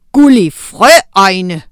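loud, slow call of a name; loud drawn-out shout. A narrator's reading. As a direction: shout the name across the forest. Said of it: "råbte Ado Vortesvin".

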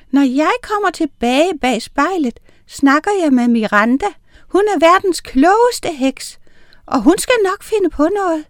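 high, eager tiny witch full of hope; little witch voice. A narrator's reading. Tiny witch promises to ask Miranda for help, high and eager.

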